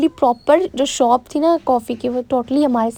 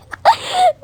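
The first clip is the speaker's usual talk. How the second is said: a short, very high giggle. Real, not acted. Vlogger laughing at taking her own snap first, a high giggle.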